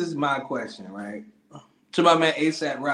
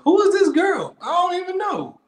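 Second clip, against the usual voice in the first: mock confused stranger; 13 semitones higher, 4 dB louder than usual. Mocking confused person in line.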